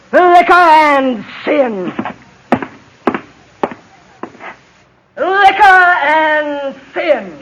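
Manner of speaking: drawn-out condemning intonation, word by word; a righteous crusader slowly intoning her warning; slow solemn denunciation. Acted. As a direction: pronounce it slowly like a sentence of doom. Stern temperance lady condemns them.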